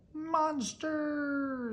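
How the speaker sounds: high, loud joyful cheer. Acted. The little terrors cheer their monster, high and loud. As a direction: cheer it out together. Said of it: "they all cheered together".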